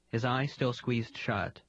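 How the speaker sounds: usual speaking voice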